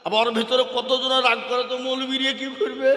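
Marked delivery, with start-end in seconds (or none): none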